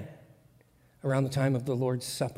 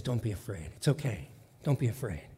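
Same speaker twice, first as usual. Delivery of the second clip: soft, low reassurance; gentle comfort; 6 semitones lower, 8 dB quieter than usual. Acted. Jesus calming his frightened friends, soft and low. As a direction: soothe them quietly.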